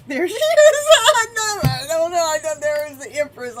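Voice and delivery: high, loud, laughing squeal of surprise; real excited laughing outburst; delighted squeal. Real, not acted. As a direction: shriek with joy as the card shows up. Empress card suddenly reappears.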